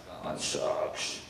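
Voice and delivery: slow, breathy letdown under the breath; sinking whisper of disappointment; deflated whisper. Retold or imitated. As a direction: let the air out of it. Deflated reply to rejection.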